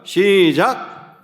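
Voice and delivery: drawn-out count-in call; long, projected cue to begin; group reading cue. Real, not acted. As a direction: stretch it out to start everyone together. Cueing congregation to read aloud.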